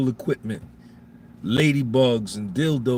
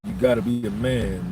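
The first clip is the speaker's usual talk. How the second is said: usual speaking voice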